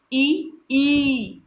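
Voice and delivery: first "ई" short, second "ई" stretched long. Shown as a demonstration. Teacher contrasts short and long "ई" for the class. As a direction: say "ई" quick, then drawn out. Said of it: "ये ई जब बोल रहे हैं छोटी".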